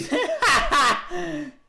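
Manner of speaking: loud, high-pitched cackle; helpless high laughing fit; burst of laughter. Real, not acted. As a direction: burst into a shrieking laugh mid-sentence. Bursts out laughing mid-sentence.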